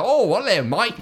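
high, mock Australian bloke voice; Australian accent. Imitating an Australian jerk cast as Kano, Australian accent. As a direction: toss it off like a cocky Australian. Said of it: "he's like".

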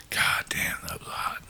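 whispered, savoring the memory; appreciative whisper. Real, not acted. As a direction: breathe it out in awe. Recalling attractive parole officer.

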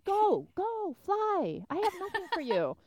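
high, exasperated plea to a pest; acted-out high-pitched exasperation; exasperated shooing.

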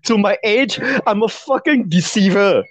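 high-pitched, incredulous outburst; exasperated rant. Real, not acted. Guy ranting that nothing about him matches, exasperated. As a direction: rant in rising disbelief.

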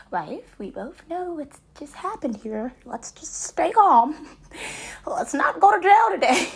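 a hint of hammed-up calm; mock calm plea. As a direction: coax her to keep calm. Calming wife in the store.

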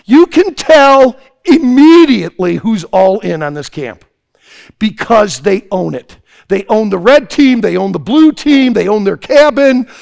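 loud, high preaching. Acted. Preacher praising committed campers: full-force preaching. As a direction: fire up the campers.

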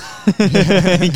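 real loud laugh. Real, not acted. Laughing at film title joke.

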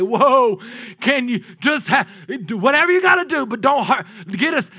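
high, loud frantic begging voice; pleading demons. Retold or imitated. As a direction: beg loudly in a panic, voice high. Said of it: "run up ... and say".

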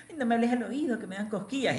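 put-on a coy girlish hint as she complains of tickles; girlfriend's voice. Retelling his girlfriend complaining about tickles, a light coy girl voice. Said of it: "viene la magia y me dice".

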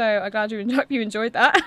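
giggling through the words; real laughter breaking in; laughing. Real, not acted. Laughing at her own burp.

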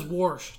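warsh, with an r slipped in before the sh; Pittsburgh pron. Shown as a demonstration. Host demoing the Pittsburgh warsh, with an added r. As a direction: say warsh, adding an r after the vowel. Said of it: "It's not wash. It's warsh".

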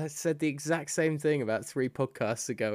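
usual speaking voice

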